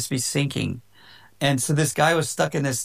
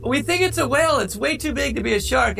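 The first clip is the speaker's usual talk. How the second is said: much higher-pitched announcer voice; imitated announcer; 12 semitones higher than usual. Announcer interviewing surfer coming out of the water, imitated announcer. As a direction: mimic an announcer calling out to a swimmer. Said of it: "there was an announcer there".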